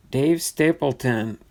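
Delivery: normal talking voice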